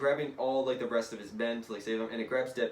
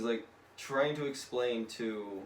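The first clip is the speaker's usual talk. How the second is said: ordinary talk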